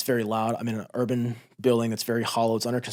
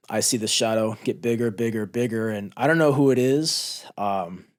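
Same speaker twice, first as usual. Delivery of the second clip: ordinary talk, nothing marked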